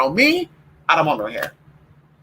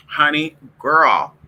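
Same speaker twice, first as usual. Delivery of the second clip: ordinary talk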